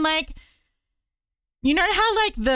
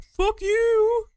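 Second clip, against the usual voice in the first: imagined Jesus shouting; 13 semitones higher than usual. Retold or imitated. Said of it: "being like".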